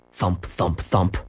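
low, hollow thump. A narrator's reading. Dog scratching inside a phone booth, low hollow thumps. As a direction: drop the voice to imitate dull thumps. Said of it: "the thump sounded low and hollow".

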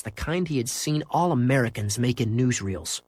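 ordinary delivery

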